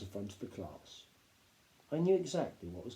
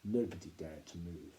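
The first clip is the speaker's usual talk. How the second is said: usual speaking voice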